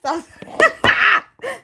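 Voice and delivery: high, loud helpless laughter; cackling. Real, not acted. Laughing after the mock rebuff, high cackle.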